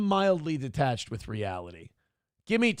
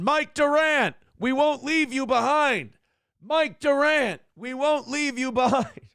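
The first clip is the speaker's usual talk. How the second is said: loudspeaker call; 8 semitones higher, slower than usual. Retold or imitated. Helicopter loudspeaker movie quote.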